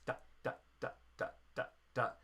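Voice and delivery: short detached "da, da, da" like staccato notes; clipped "da" syllables for staccato. Shown as a demonstration. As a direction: bounce each "da" short and separate. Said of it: "staccato for the eighth notes".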